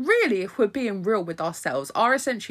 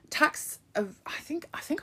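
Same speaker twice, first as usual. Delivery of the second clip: laughing whisper; 11 semitones higher, 4 dB quieter than usual. Real, not acted.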